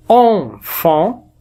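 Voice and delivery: Enfant with both syllables nasal, an as in an; model nasal an. Shown as a demonstration. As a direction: say Enfant slowly, nasal an twice. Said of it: "c'est écrit E N".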